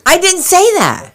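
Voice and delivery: high-pitched indignant cry; exasperated protest. Acted. Wife correcting her mishearing husband, exasperated protest.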